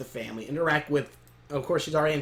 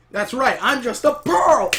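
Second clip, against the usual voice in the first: loud, higher, slow mock yell; defiant retort; 8 semitones higher, 8 dB louder, slower than usual. Pearl to Peridot before the punch, defiant shout. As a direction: throw it back loudly, stretching the words.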